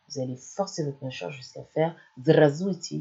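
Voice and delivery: usual speaking voice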